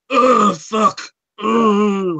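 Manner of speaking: loud, high waking groan; hungover groan. Retold or imitated. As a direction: groan like you've woken up hungover. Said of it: "you're so hungover and you kind of wake up".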